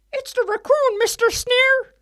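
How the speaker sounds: very high, loud squeaky henchman; cartoon pig impression. Pig henchman reporting to the boss, very high cartoon voice. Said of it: "And the pigs would say".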